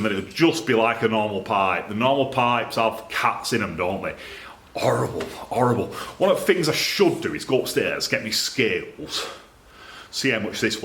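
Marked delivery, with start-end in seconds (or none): 4.8-5.9 a hushed, disgusted whisper, horrible, horrible. Acted. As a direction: breathe it out as if the thing is revolting. Mock disgust at catalytic pipes.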